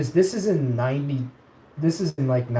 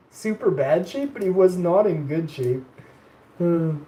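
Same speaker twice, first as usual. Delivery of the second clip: usual speaking voice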